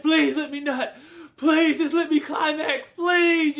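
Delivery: hammed-up begging for release. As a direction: plead desperately, half laughing. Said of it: "he's just begging, just begging".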